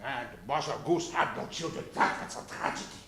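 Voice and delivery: put-on hushed, low German-accented lament; mock tragic. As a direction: drop to a soft, grave hush. Narrates a mock tragedy.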